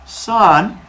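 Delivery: a hint of a stern dad; knowing Zeus. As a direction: greet your son like you already know everything. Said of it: "He was like".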